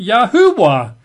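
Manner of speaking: Yahuwah said as ya-HOO-wah; three-syllable pronunciation. Shown as a demonstration. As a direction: sound Yahuwah with a clear middle HOO. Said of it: "say it should be".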